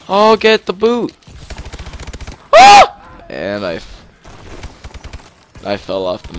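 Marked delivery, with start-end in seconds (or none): 2.5-3.2 high startled scream. Real, not acted. Falling off the map.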